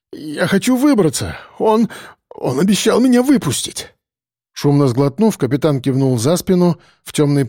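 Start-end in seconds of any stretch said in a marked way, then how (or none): 0.1-3.9 higher, halting, pleading voice; stammering. A narrator's reading. Frightened policeman clings to promised release, faltering plea. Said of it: "пробормотал он, заикаясь".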